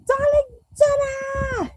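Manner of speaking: high, slow, drawn-out shout; mock scream of a chat member's name. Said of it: "There was me screaming".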